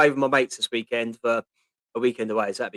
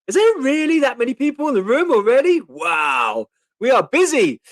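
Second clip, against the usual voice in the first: surprised; 10 semitones higher, 6 dB louder than usual. Real, not acted. Surprised at the crowded stream.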